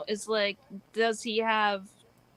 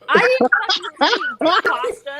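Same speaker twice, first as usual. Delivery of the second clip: defensive shout; 9 semitones higher, 10 dB louder than usual. Real, not acted. Defensive outburst after being teased.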